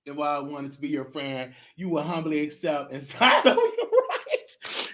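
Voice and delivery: low, soft villain voice with laugh; menacing quiet aside; sinister whisper. Pretend.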